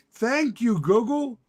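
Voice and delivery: hammed-up gratitude to the screen; mock thanks. Real, not acted. Streamer sarcastically thanking Google, high loud mock gratitude.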